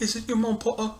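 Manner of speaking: high, soft sneering taunt; mocking high voice. Friend copies the taunt at Potter, high and soft. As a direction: pitch it way up and mock.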